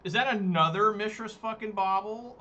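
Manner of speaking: can't believe this card again; outraged disbelief. Real, not acted. Opponent plays another Bauble again.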